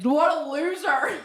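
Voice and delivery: slow, high, laughing taunt; gleeful playful mockery. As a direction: tease him for missing. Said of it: "We're so mean".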